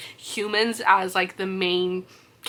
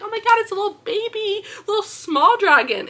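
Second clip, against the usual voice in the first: gushing; 11 semitones higher than usual. Retold or imitated. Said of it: "She's like".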